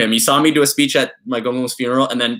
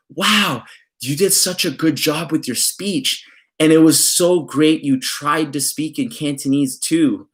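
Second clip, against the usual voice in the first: high, slow, sugary aunt voice; a hammed-up condescending auntie, drawn out; aunt's backhanded praise; 4 semitones higher, slower than usual. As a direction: praise him sweetly while putting him down. Aunt's backhanded compliment after speech.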